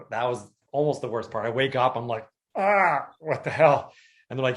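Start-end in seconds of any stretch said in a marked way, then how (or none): 2.6-3.9 pained outcry. Retold or imitated. Waking from surgery in pain.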